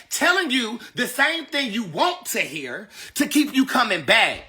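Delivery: ordinary talk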